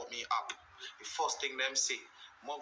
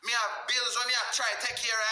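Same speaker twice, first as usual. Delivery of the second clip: ordinary talk, nothing marked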